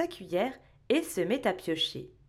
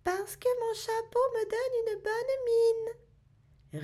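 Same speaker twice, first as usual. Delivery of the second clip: tiny gruff elf, much higher; gruff little voice; 12 semitones higher than usual. Acted. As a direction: squeeze it into a tiny grumpy elf voice. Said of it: "une petite voix bourrue". (petite voix bourrue de lutin)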